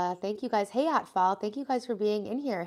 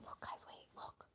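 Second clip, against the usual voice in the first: much softer, breathy hush; real whispered aside to the chat; sudden whisper; 20 dB quieter than usual. Real, not acted. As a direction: drop to a secret whisper.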